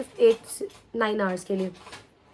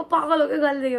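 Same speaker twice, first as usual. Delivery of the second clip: re-enacted pained outburst, raised and louder; retold angry yelling; 5 semitones higher, 6 dB louder than usual. Patient scolding mother for filming her, retold angry yell. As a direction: snap at her as you retell it. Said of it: "मैं बहुत तेज तेज चिल्ला रही थी".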